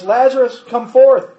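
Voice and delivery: a loud, high call to the dead man; Jesus crying out at the tomb, loud. Acted. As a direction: cry out with full voice. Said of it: "he cried out with a loud voice".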